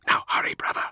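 hurried unvoiced whisper; urgent whisper. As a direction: whisper to hurry him. Whispered urging.

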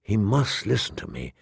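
low, hushed insistence; will it silently, barely voiced aloud; inward thought. A narrator's reading. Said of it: "she thought".

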